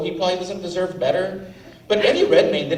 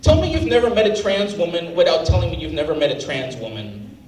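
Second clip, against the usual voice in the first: ordinary talk, nothing marked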